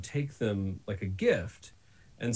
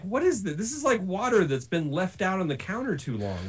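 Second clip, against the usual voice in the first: incredulous; 7 semitones higher, 4 dB louder than usual. Retold or imitated. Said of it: "And I was like".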